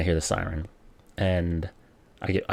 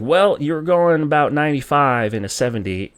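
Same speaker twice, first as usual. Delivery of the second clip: raised, louder mock cop voice; cop's reproof; 7 semitones higher, 5 dB louder than usual. Cop tells the driver he was speeding, mock reproof. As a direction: put on a cop pointing out the offence. Cop's stern rebuke acted in story.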